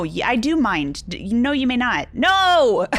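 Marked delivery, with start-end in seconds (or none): 2.1-2.9 loud, high "No!"; sharp refusal. Acted. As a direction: refuse it with a shout. Refusing to go to bed.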